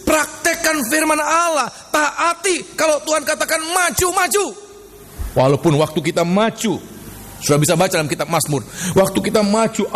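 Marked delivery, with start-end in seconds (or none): 0.1-4.4 high, stretched, ringing commands; rousing exhortation. Real, not acted. Preacher commanding the congregation to obey and advance, rousing exhortation. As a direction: drive the listeners onward with raised preacher's cries.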